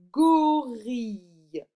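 loud, slowly stretched word; slow dictation. Shown as a demonstration. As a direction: dictate it with long syllables. Teacher dictating words for sound exercise. (dictée lente et forte)

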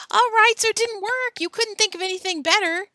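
high, loud comeback; angry retort. Acted. Joe defends his failed trick to Alan, angry retort. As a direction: shout back defensively. Joe yells back in quarrel.

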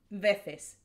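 "veces" said "vethes" with a lisp; Castilian lisped C. Shown as a demonstration. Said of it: "like with a lisp".

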